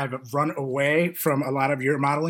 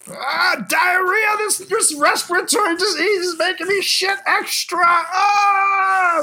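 much louder, higher hammed-up shriek; mock panic yell; 10 dB louder, slower than usual. Mocking panicked shoppers for the listener, hammed-up high yelling. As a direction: scream it like a panicking hoarder. Parodying panicked toilet paper buyer.

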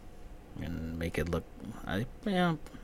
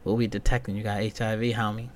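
normal talking voice

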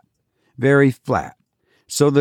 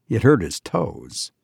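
plain reading voice

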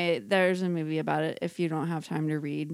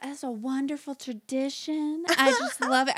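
put-on southern accent; 7 semitones higher than usual. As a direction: gush in a sweet southern accent. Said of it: "like, a southern".

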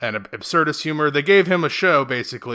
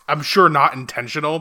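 ordinary talk, nothing marked

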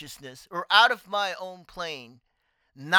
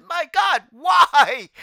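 higher, louder cry of disbelief; mock anguished cry; 7 semitones higher, 8 dB louder, much slower than usual. Drunk kid scolding himself, higher and louder cry. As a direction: throw it up to the sky half-joking. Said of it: "I was like".

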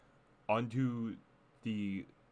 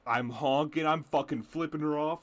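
normal talking voice

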